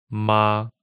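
sustained flat "ma", no rise or fall; first tone. Shown as a demonstration. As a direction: say "ma" on one unchanging flat pitch. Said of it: "pronounce ma for us in the first tone".

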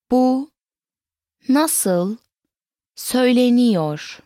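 slow, each word set apart by pauses; word-by-word model. Shown as a demonstration. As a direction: pause fully between words for a learner. Teacher breaks question into words.

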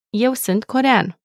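ordinary talk

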